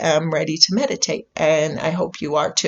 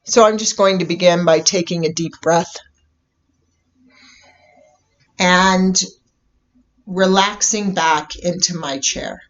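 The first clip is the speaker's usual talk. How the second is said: guided meditation. Real, not acted. Host leading listeners into a bubble meditation, calm guided meditation. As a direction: ease them into stillness, unhurried, letting each phrase settle.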